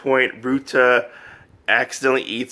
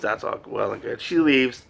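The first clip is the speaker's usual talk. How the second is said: ordinary talk, nothing marked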